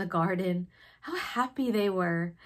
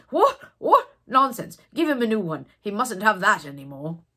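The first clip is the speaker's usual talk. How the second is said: indignant outburst; 9 dB louder than usual. Acted. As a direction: scoff at the idea, then lay down the law. Doctor dismisses the old bunny.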